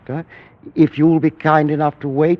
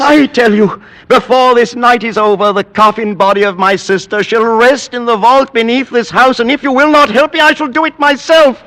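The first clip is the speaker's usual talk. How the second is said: much higher, loud, fast vehement declaration; fierce insistence; 10 semitones higher, 5 dB louder, faster than usual. Acted. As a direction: hammer down his will, refusing argument. Roderick insists on entombing sister.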